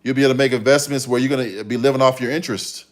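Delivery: ordinary talk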